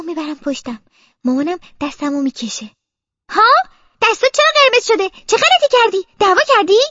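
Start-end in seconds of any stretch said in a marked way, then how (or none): 3.3-6.8 high, loud scolding questions; cross mother firing questions; angry interrogation. Acted. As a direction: demand an answer from a naughty child. Mother interrogates child angrily.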